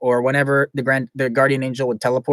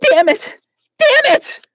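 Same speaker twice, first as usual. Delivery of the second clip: high, loud frustrated outburst; bad Glacia voice; 6 dB louder, slower than usual. Glacia cursing her failure, high and loud. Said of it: "No, that was really bad".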